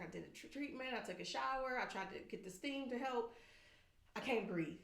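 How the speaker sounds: weak husband. Retold or imitated. Husband quoted struggling to breathe.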